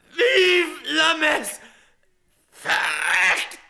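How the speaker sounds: high, drawn-out final battle cry; defiant last cries. Acted.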